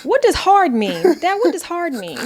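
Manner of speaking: put-on high-pitched, puzzled kid's question; young son asking. Son asks dad what slang means, high childlike imitation. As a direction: imitate a curious little boy pestering his dad. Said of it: "Nolan said".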